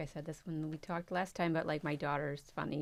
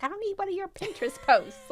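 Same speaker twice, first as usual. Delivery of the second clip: much higher, louder eye-rolling snark; exasperated teen daughter; 9 dB louder than usual. Retold or imitated. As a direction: dismiss mom's sayings like a fed-up teen. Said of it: "she's like".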